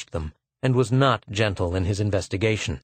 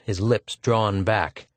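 ordinary delivery

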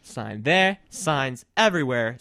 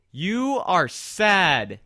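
very slow teasing; 6 semitones higher, 5 dB louder, much slower than usual. Real, not acted. Teasing co-host.

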